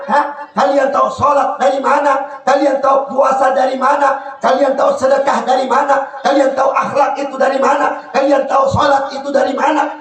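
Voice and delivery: impassioned preacher hammering questions; fiery preaching. Acted. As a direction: preach it hard to the crowd. Preacher exhorting the crowd loudly.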